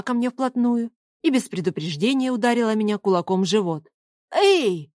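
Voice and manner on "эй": high-pitched indignant yelp. A narrator's reading. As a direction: protest sharply in one syllable. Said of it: "возмутился я". (возмущённый вскрик)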